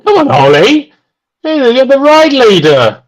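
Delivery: high-pitched, excited urging. Real, not acted. Commentator calling to the ride leader, urging on. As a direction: call out high, egging the rider on.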